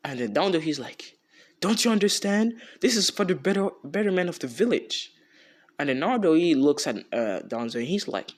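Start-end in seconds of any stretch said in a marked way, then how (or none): 1.6-5.1 high, raised voice arguing his case; loud, high-pitched acted protest; indignant pleading. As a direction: imitate an exasperated man insisting on his point. Danzo argues with Naruto.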